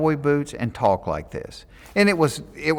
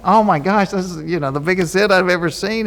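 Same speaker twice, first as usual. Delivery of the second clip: higher-pitched, exaggerated amazement; mock amazement; 7 semitones higher than usual. Ribbing his brother over a huge zit, mock amazement. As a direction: make a mock fuss, pitched up.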